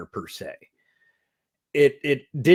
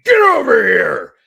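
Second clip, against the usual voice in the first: loud, high-pitched impression of a game catchphrase scream; imitated full-force scream; Ed Boon scream; 10 semitones higher, 11 dB louder than usual. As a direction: belt it out like a fighting-game battle cry. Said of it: "still Ed Boon screaming".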